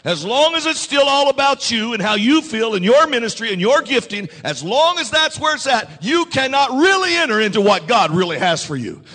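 fervent preacher crescendo; preaching at full force. Acted. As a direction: drive it home to the pews.

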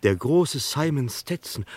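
thrilled disbelief as the penny drops; excited recognition. Acted. Gloster tells Henry that the corpse is the great Stetson, excited and loud.

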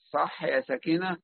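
ordinary talk

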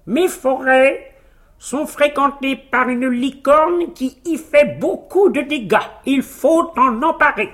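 high, slow, declaiming royal voice; commanding king. Acted. King orders the hero to catch the unicorn, declaiming. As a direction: proclaim it grandly, pitched high.

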